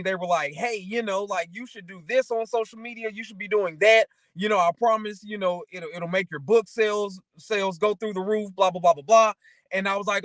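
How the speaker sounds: ordinary delivery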